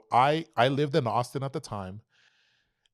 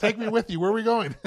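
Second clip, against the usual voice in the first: put-on eager kid; 12 semitones higher, 4 dB louder than usual. Eager kid in candy van.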